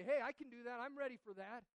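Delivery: loud, breezy mock boast; cocky volunteering. Preacher mocking a cocky reply to God, loud and breezy. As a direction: toss it off like it's easy.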